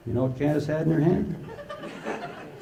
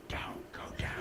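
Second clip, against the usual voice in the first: hushed warning whisper; 18 dB quieter than usual. Retold or imitated. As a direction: whisper it to the screen. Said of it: "we're in the audience saying".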